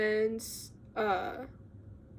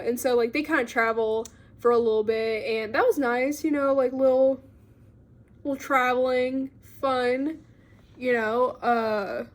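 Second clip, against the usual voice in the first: ordinary talk, nothing marked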